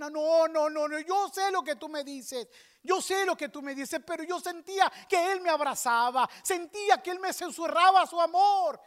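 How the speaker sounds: loud, high, desperate insistence; impassioned protest. Retold or imitated. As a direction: act out a distraught woman crying her objection. Acts friend protesting passionately. (protesta desesperada a gritos)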